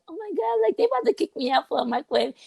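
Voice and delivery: high, fast mock panic; naive panic. Naive student panicking to the guard about the microwave. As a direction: gasp it out like a naive kid. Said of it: "like, naive".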